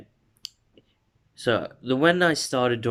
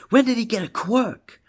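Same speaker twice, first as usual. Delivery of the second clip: much higher, louder astonished question; incredulous outburst; 13 semitones higher, 5 dB louder than usual. Acted. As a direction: burst out in disbelief. Character stunned by sudden power.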